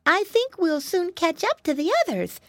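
high storybook piglet voice; piglet boy. Acted. Flop to his brother in the cornfield, high piglet voice. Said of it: "said Flop after a bit".

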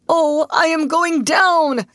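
high, loud, drawn-out wail; anguished cry. A narrator's reading. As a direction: wail in panic as the bog pulls you under. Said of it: "cried Mister Bunn in anguished tones".

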